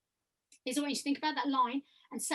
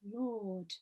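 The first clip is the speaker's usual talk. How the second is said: "Lord" low and much softer; low, close placement; 4 semitones lower, 10 dB quieter than usual. Shown as a demonstration. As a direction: bring "Lord" down close, quietly. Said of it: "still place them in those places".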